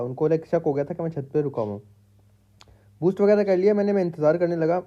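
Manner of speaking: ordinary talk, nothing marked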